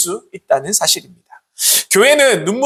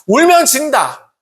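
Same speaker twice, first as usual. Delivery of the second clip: higher, louder, slow mock adage; stern saying; 7 semitones higher, 4 dB louder, slower than usual. Preacher voices people's harsh saying to a crying child, higher and slow. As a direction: recite the harsh old saying slowly. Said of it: "이런 이야기 어릴 때부터 많이 들어보셨죠".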